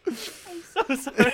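high, slow apology through helpless laughter; real laughing-to-tears squeak; crying with laughter. Real, not acted. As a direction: apologize while cracking up. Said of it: "I'm crying".

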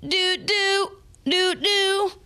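high squeaky doll babble; mechanical crying doll. Acted. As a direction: babble like a wind-up talking doll.

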